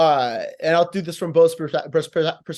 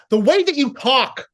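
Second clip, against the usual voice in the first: high, loud burst; brought up; 7 semitones higher, 5 dB louder than usual. Shown as a demonstration. Coach showing a wrestler how to bring it up, raised voice. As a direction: jump up high and punch it out. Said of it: "you could bring it up".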